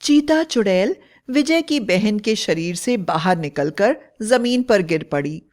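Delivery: usual speaking voice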